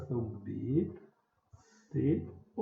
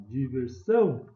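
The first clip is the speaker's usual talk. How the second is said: SÃO called louder in Diversão; calling out; 5 dB louder, slower than usual. Shown as a demonstration. Teacher pretending to call at the gate, stressed SÃO in Diversão. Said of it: "vou chamar a diversão".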